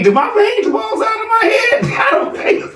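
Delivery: laughing high. Real, not acted. Host asking Miss B about his veins, laughing high. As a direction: crack up while asking. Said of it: "I wanna ask Miss B".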